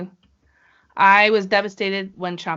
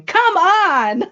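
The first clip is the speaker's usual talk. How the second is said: high, loud, drawn-out shout; exasperated yell; 11 semitones higher, 8 dB louder than usual. Retold or imitated. Wrestling fan yelling at the Twitter keyboard, loud exasperated shout. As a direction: yell it out at the match. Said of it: "I was yelling at my Twitter keyboard".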